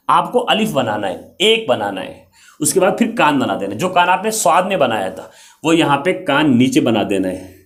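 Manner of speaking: normal talking voice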